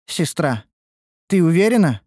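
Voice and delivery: wavering, unsure of the decision; hesitant. A narrator's reading. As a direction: ask it doubtfully, pausing after the address. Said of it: "поколебалась Ульданте".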